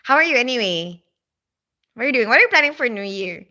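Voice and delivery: usual speaking voice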